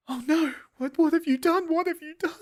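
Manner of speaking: squeaky melodramatic what have you done; mock distraught Juliet. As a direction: overact her shock in falsetto. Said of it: "she's like".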